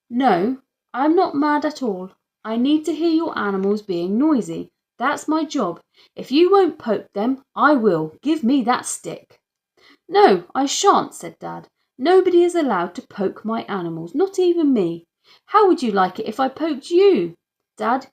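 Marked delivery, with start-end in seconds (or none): 0.1-9.4 louder, higher bossy insistence; insistent official. Acted. Inspector argues with Dad, louder and bossy. 10.1-11.1 loud, slow, higher refusal; firm refusal. Acted. Dad refuses the inspector, loud and slow. 12.0-17.3 louder, higher angry protest; angry dad. Acted. Dad shouts at the inspector over poking animals.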